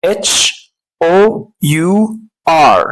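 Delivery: slow letter-by-letter "H, O, U, R"; تهجئة. Shown as a demonstration. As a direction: spell it out with pauses between letters. Spelling the word aloud. (تهجئة "H O U R" ببطء)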